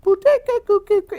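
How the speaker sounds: high-pitched boops and beeps; rapid mock keypad tones; imitated dialing beeps. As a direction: mimic a fax machine dialing, loud and high. Imitates dialing a fax machine.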